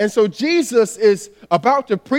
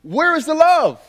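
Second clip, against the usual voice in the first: high-pitched, drawn-out appeal; acted plea, pitched well up; pleading challenge; 7 semitones higher than usual. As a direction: call it out as a hurt appeal for love. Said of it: "kinda lift our hands up and say".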